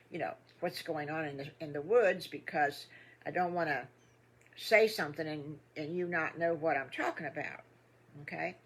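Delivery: usual speaking voice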